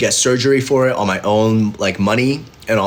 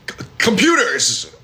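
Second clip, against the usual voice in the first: evasive stammer; 4 semitones higher, slower than usual. Retold or imitated. Evasive answer to clerk.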